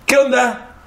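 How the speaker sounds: a big soldier joking about football; mock soldier. Huge soldier quoted teasing him.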